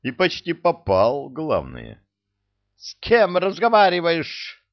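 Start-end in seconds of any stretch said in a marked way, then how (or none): 2.8-4.6 indignant official. Acted. Outraged official yells at hero.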